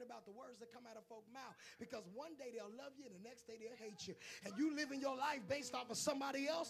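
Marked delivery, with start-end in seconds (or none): 4.4-6.7 full-force sermon; preaching. Acted. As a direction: hammer each line home.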